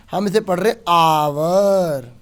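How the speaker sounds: slow आवर with no h sound, starting on the vowel; teacher showing the silent h; silent h in hour. Shown as a demonstration. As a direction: drop the h and say आवर slowly. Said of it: "इसमें एच को तो पढ़ते ही नहीं है".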